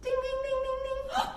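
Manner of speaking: high sung timer ding; sing-song pretend oven bell. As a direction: chime like a kitchen timer.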